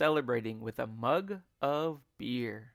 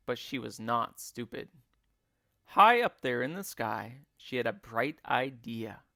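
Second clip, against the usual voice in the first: ordinary delivery, nothing marked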